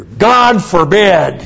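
slow, high, emphatic God forbid with a laugh; emphatic exclamation. Shown as a demonstration. Pastor savoring the King James rendering, emphatic. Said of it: "I kinda like the King James Version here".

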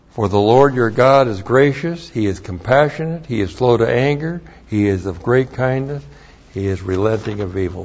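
usual speaking voice

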